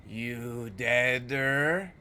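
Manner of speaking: slow, drawn-out ghoulish rasp; creepy ghoul voice. Acted. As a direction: drool over each word like a starving ghoul. Voicing a diseased beggar.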